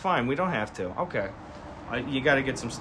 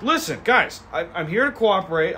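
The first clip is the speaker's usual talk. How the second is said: mock earnest driver; 6 semitones higher, 3 dB louder than usual. Driver pleading with cops.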